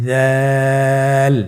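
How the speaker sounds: দাল held long with the tongue tip at the teeth; long held Arabic letter; drawn-out letter. Shown as a demonstration. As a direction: stretch দাল slowly. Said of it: "আবার বলুন".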